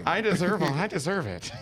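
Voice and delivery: indulgent wine mom; treating herself like a wine mom. Acted. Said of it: "like a wine mom".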